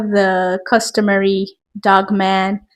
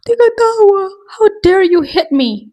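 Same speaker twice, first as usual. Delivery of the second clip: high, loud, put-upon shriek of "how dare you hit me"; mock-wounded girl shrieking "how dare you hit me"; outraged green tea; 10 semitones higher, 3 dB louder than usual. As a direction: play the wronged innocent, hammed-up. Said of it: "the green tea's like".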